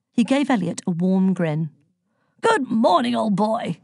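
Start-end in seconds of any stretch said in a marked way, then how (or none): 2.4-3.8 hearty, higher-pitched greeting; cheerful, mildly roared welcome; happy roar. A narrator's reading. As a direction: boom a jovial hello like an old gent. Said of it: "he roared happily".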